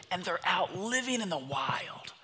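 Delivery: put-on high, wonder-struck kid voice; awestruck son. Son describes hunters in pictures to dad, awestruck.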